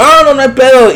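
eager reassurance. Retold or imitated. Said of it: "Le digo".

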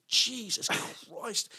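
soft, very slow, high; quiet retold dread; appalled aside. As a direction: stretch it out in hushed disbelief. Thinking it as prank gets worse.

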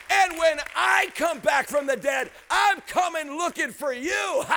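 slow, booming declaration; loud, stretched-out promise of return; retold loud warning. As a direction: declare it like a preacher's drawn-out threat. Mock warning retold to the gunman.

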